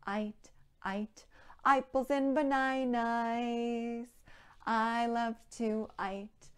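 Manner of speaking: sung with eye sounds for the vowels; I sound verse. Shown as a demonstration. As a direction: sing "ite" and "eyeballs" with a long eye vowel. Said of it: "with an I sound".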